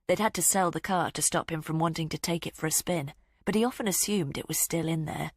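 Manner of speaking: ordinary delivery, nothing marked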